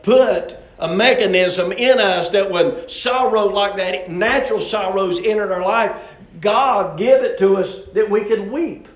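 impassioned, loud preacher; forceful preaching. Real, not acted. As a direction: drive it home to the congregation.

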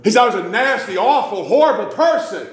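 high, loud addict insisting; yelling crackhead voice. Retold or imitated. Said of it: "he's yelling".

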